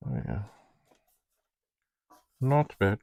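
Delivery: ordinary delivery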